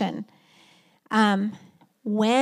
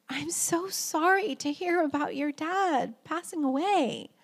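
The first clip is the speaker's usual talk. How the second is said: sad empathy; 4 semitones higher, 6 dB quieter than usual. Retold or imitated. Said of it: "with the most empathetic, sad, emotion".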